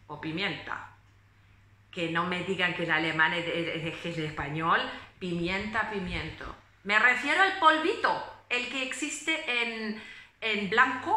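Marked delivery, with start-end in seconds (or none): none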